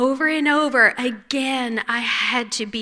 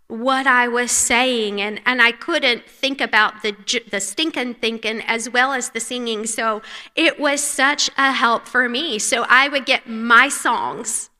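usual speaking voice

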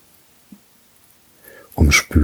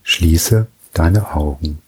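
usual speaking voice

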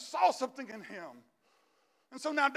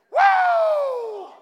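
ecstatic whoop; 14 dB louder than usual. Real, not acted. Preacher whoops at climax.

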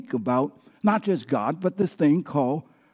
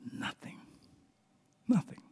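whispered emphasis; 6 semitones higher, 12 dB quieter than usual. Acted.

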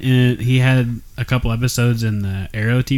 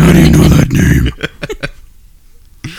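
Batman growl; 3 semitones higher, 5 dB louder than usual. Pretend. Batman Martha scene.

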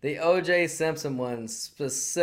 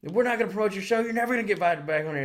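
higher mock threat, hammed up; mock angry host; 7 semitones higher, faster than usual. Hosts threatening Norm, higher mock angry warning. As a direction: wag a finger at him. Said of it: "hosts threatening Norm".

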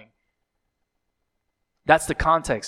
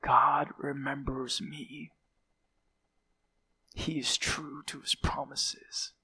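slow, soft, grateful whisper; relieved whisper; 8 dB quieter, slower than usual. Acted. The church reading the letter, whispering in relief. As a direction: breathe it with quiet relief. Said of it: "they're like".

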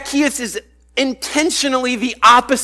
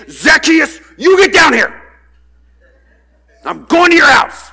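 loud, higher threatening shout; stern scolding shout, higher and louder; 7 semitones higher, 6 dB louder than usual. Retold or imitated. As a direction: yell him down like an angry parent. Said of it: "It's more like a threatening kind of thing".

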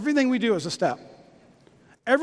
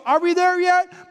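put-on impatient kid; 7 semitones higher, 3 dB louder than usual. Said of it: "I have a kid that goes".

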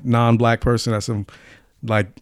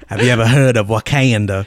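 a botched, almost Australian, Southern accent, only lightly put on; terrible accent attempt; 3 semitones lower than usual. Redoing the trailer line in a failed accent, laughing. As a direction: fumble a Southern twang that drifts Australian. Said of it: "that was a terrible accent".

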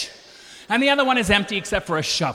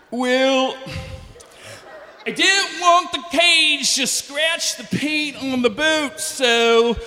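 higher, slow mock yokel captain; 4 semitones higher, slower than usual. Boat captain explains the plan, mock yokel voice. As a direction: bark it out like a laid-back boat captain.

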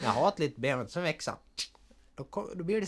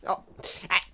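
usual speaking voice

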